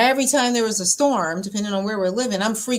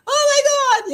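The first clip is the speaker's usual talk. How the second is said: mock panic; 7 dB louder, faster than usual. Mocking her old storm panic.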